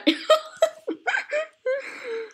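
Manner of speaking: shrill crying laugh; high laughing fit. Real, not acted. Friend's reply sets off a high laughing fit. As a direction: laugh until it breaks.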